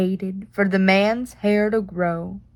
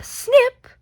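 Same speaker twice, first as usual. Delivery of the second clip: high snip; 14 semitones higher, 3 dB louder than usual. Acted. Scissors sound effect.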